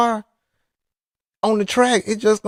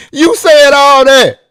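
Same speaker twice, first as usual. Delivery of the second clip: much higher and louder, slowly hammering the words; loud slow retort; 9 semitones higher, 9 dB louder, slower than usual. Real, not acted. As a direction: nail him with his own words. Retort to the friend's denial.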